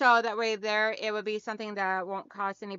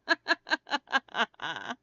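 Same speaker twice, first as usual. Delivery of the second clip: long real chuckle; amused laughter, trailing down; laughing. Real, not acted. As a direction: laugh in a run of quick ha-has. Amused during hat chat.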